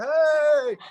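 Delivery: high, loud mock holler; imitated shout for attention; calling out. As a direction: holler as if to someone driving by. Retold waving and calling out.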